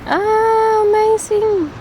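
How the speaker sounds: usual speaking voice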